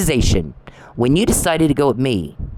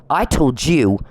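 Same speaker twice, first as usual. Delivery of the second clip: ordinary talk, nothing marked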